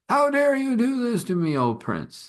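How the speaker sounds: acted royal outrage, starting very high and falling away; outraged father. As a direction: thunder "how dare you" like an affronted king, pitched high. Outraged father confronts the prince.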